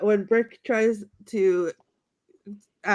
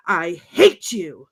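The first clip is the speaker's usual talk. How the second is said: slow, drawn-out stress on HATE; mock-venomous, deliberate delivery; emphatic hatred; 3 semitones lower, 5 dB louder, slower than usual. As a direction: stretch and punch "hate". Repeated acted line of hatred.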